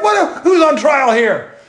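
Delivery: high, incredulous what, then slowing down; mock indignant. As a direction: act affronted at the question. Retold indignant reply to doctor.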